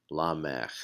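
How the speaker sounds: Lamech repeated softly, lah-MEH. Shown as a demonstration. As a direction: Lamech with no final vowel. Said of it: "is pronounced".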